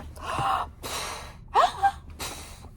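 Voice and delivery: very high whistling gasp; exhausted wolf. Acted. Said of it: "He's all".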